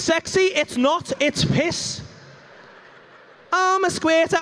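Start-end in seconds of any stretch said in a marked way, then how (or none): 3.5-4.4 mock proud woman. Mocking the woman's excuse.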